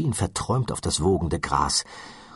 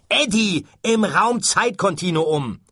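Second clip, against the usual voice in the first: slow, higher, louder yell; shouting slowly; 12 semitones higher, 5 dB louder than usual. Acted. Ford shouting the explanation at Arthur, slow yell. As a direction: spell it out at full volume. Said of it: "schrie Ford".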